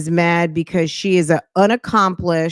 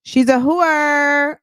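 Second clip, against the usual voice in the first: much higher, slow emphatic insult; slow emphatic jab; 9 semitones higher, much slower than usual. Real, not acted. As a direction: drag it out for effect.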